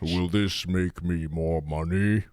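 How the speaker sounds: slow villain boss. Pretend. Bezos character asks.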